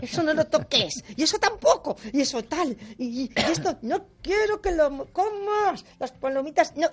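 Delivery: put-on a fraying mother snapping at her kid; imitating a tense mother scolding her small child; trembling angry mother. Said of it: "y les tiembla la voz".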